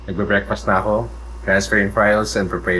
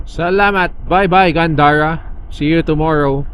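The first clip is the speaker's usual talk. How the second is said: loud farewell; 6 semitones higher, 4 dB louder than usual. Real, not acted. Loud farewell while riding.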